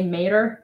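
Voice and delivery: "Mater" as MAY-der, first syllable dropped from tomato; Southern pronunciation. Shown as a demonstration. Joking about living in the South, "Mater" as Southern MAY-ter. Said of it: "live in the South and say".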